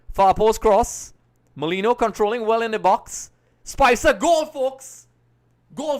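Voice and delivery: goal call. Real, not acted. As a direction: erupt as the ball goes in. Commentating the equalizing goal live.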